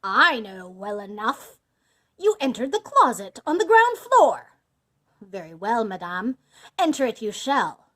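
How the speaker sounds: loud, forceful villain passing sentence; booming accusation; angry condemnation. A narrator's reading. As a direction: pronounce the doom with rising force. Bluebeard condemns his wife.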